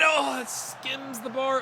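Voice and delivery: high, drawn-out commentator cry; excited near-miss call. Real, not acted. Commentator watches the shot skim the bar, high excited cry. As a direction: stretch it out as the shot flies.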